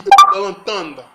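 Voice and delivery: suddenly booming out to the viewers; loud burst. Real, not acted. Vlogger hyping his subscribers, loud sudden burst. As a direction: punch it out loud to grab them.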